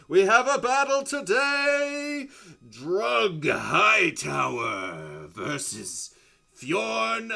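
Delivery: booming fight announcer, very drawn-out shout; Mordecai. Acted. Tiefling announcer calls the matchup, extra boomingly loud. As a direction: stretch each name for the whole arena. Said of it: "speak extra boomingly loud to everyone".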